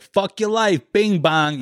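high-pitched New York street-guy impression; guy from video. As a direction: imitate a brash New Yorker's catchphrase. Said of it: "and the guy's like".

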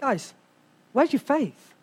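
soft, calm acted reproach; quiet questioning. Jesus to the disciples after the storm, quiet questioning. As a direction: ask it softly after a long pause.

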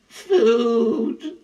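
high, drawn-out moaning wail. Acted. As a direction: moan it weakly from the grass, high-pitched. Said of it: "Grover moaned".

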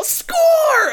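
high and loud shriek; excited kid yell. Retold or imitated. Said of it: "throw the Woody doll".

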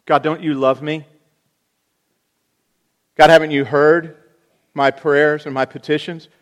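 mock outraged prayer; loud complaint. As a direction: demand answers from God. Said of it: "Maybe something like this".

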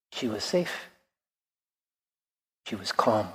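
soft, low and reverent; hushed calm. A narrator's reading. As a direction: let the voice drop to a gentle hush. Calm ending of the story.

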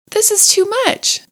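high, breathless delight; overwhelmed delight. A narrator's reading. Mole overwhelmed by the picnic list, high delight. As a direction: gush it, overcome. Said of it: "cried the mole".

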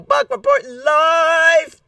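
slow, high announcer voice; mock news reporter. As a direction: play a live TV reporter. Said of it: "I'm kidding".

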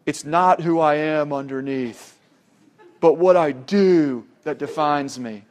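gruff Batman impression. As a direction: imitate a real gruff movie-hero growl, deliberately.

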